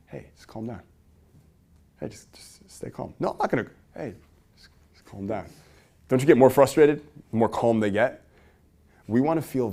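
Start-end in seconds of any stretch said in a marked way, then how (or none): 0.1-3.1 hushed, near-whispered soothing; super calm. Acted. Calm bystander to someone yelling about a problem, super calm. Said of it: "the other person is just super calm". 3.2-3.7 agitated refusal. Acted. Acted worked-up person refusing to calm. 3.9-5.5 hushed, near-whispered soothing; super calm. Acted. Calm bystander to someone yelling about a problem, super calm. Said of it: "the other person is just super calm".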